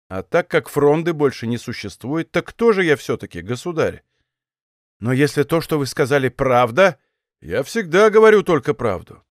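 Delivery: ordinary delivery, nothing marked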